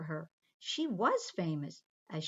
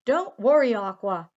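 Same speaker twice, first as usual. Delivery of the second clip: louder, firm shout to calm her; loud reassurance; 3 semitones higher, 12 dB louder than usual. Acted. Peter shouting to Aqua that he is coming to save her. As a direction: call out to reassure her over the noise.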